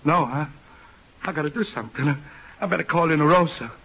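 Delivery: ordinary talk, nothing marked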